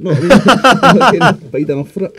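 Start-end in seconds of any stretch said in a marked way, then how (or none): 0.4-1.2 bursts out in a big loud laugh; loud high laugh. Real, not acted. Laughing hard at his papa's joke about smoking. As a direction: cracks up at his own story.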